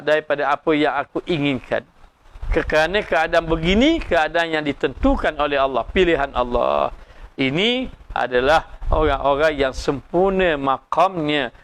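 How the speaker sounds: normal talking voice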